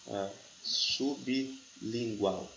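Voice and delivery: "sublingual" spelled out syllable by syllable, very slow; word split slowly. Shown as a demonstration. Teacher slowly splits sublingual for students. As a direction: stretch "sublingual" into its syllables, su-bi-lin-gual. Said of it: "mas observe essa palavra aqui".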